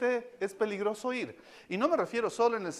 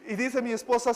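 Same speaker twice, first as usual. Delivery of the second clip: usual speaking voice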